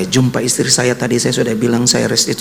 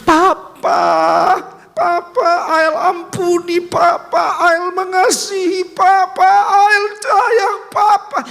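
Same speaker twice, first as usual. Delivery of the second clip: put-on much higher, slow child's cry, louder; 3 dB louder, slower than usual. Young son hugs his father's neck, crying out. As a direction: cry out like a sobbing little boy. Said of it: "dan berteriak".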